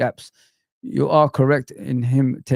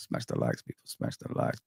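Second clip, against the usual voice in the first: hushed call; 12 dB quieter than usual. Real, not acted. Calling chat to like, hushed.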